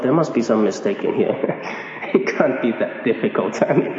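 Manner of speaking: low, chuckling protest; mock an incredulous listener, laughing between phrases; amused, half-laughing appeal to reason; imagined objector. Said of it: "you're gonna say to yourself".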